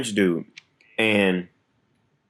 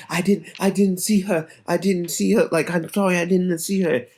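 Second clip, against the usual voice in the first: scared apology; 9 semitones higher than usual. Retold or imitated.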